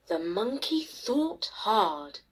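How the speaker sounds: ordinary delivery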